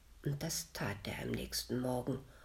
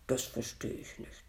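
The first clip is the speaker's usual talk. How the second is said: lower, soft puzzled butcher; puzzled undertone; 4 semitones lower, 4 dB quieter than usual. A narrator's reading. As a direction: drop low, as if not understanding. Butcher plays dumb to ghost. (leise und verständnislos)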